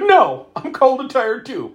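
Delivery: high-pitched whiny comeback; hammed-up petulant refusal; mock complaining. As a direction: imitate a girlfriend griping back. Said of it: "she's like".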